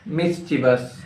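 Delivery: "mischievous" as MIS-chi-vus, falling; corrected MISCHIEVOUS. Shown as a demonstration. Teacher correcting learners, "mischievous" said as MIS-chi-vus. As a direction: say "mischievous" in three syllables. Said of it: "It's not mischievous".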